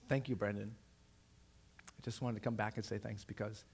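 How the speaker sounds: very soft, low, halting thanks; tearful gratitude, hushed. Retold or imitated. Nineteen-year-old student thanking her teacher, hushed and moved. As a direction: say it softly with long pauses, deeply moved. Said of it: "her face is full with tears".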